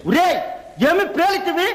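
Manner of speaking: high, ranting mythological-play villain; stage villain. Acted. Son playing the villain in their folk play, ranting. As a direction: declaim like a Bhagavatam stage villain. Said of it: "villain, villain".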